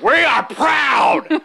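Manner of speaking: high, slow shouted chant; proud shout. Pretend. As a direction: yell it like a parade chant. Mock pride parade chant.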